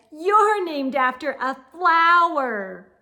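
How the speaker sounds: snooty taunt. A narrator's reading. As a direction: sneer at the flower name. Victoria mocking.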